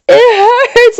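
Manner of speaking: very high wail of pain. A narrator's reading. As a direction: cry out in agony, pitch way up. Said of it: "I wailed".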